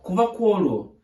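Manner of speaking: plain reading voice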